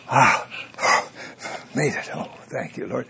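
panting, mostly breath with little voice. Acted. Man safe home after fleeing lion and bear, panting.

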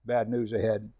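ordinary talk

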